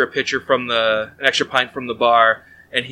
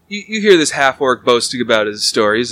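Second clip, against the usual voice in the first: ordinary talk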